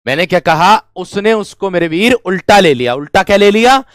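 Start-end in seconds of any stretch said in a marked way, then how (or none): none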